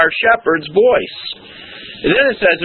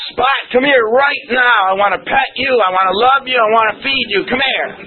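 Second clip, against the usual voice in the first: harsh call to a dog; 7 semitones higher than usual. Pretend. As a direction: bark orders at the puppy and scare it off. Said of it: "how you call your puppy dog".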